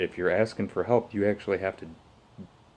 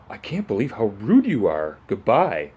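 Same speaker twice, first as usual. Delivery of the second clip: louder, put-on offended voice, slamming the goodbye; mock outraged woman; 3 semitones higher, 7 dB louder than usual. Imitating the bed lady storming off, louder and mock offended. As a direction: ham up her indignation.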